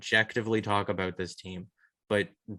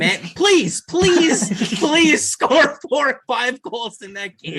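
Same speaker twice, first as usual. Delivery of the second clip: loud, much higher pleading, slowed on the last line; desperate begging; 13 semitones higher, 10 dB louder, slower than usual. Real, not acted. Fan loudly begging the Leafs to score goals, high and slow. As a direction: beg the team for goals at full voice.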